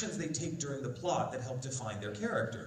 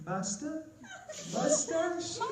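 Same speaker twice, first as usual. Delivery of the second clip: high-pitched imitated girl's voice; small, questioning, pitched far up; police girl; much slower than usual. Police girl calls to Alucard in armor, high questioning. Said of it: "and police goes like".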